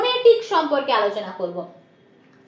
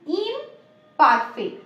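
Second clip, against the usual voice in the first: Imperfect very slow, split into ইম and perfect; slow, split word; much slower than usual. Shown as a demonstration. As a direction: dictate imperfect slowly, with a gap after ইম. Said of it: "imperfect ওয়ার্ডটাকে তোমরা যদি break করো".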